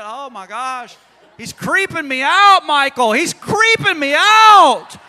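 high, wailing hammed-up fright; mock panic. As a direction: build to a loud, drawn-out mock freak-out. Acts God panicking to Michael.

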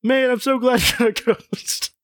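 high, hammed-up gladness with a laugh; laughing, high-voiced pretend delight; mock relief. A narrator's reading. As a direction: gush with relief, pitch way up, amused. Voices relieved character, half laughing.

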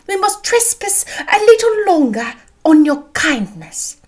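high, loud matron, dragging out the key words; fussing mother. A narrator's reading. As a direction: insist dramatically as an overanxious mother. Voices Mrs Bennet, insisting.

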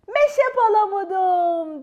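high, loud, slow cry of delight; overjoyed squirrel exclaiming; joyful shout. Acted. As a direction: shout for joy at finding it. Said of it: "diye bağırdı neşeyle".

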